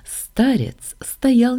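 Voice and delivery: plain reading voice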